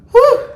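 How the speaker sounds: high, loud laughing "whew" from the onion sting; teary laughing whew. Real, not acted. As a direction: blow it out, laughing through stinging eyes. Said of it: "I'm crying".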